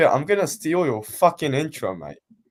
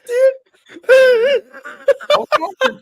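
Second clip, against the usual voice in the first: high laughing squeal; 8 dB louder, much slower than usual. Real, not acted. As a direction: break into laughter. Laughing outburst.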